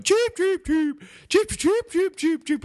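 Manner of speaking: high squeaky cheep cheep; mock chicken chirps; chicken noises. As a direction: chirp it out like a bird-man. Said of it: "he's making them chicken noises".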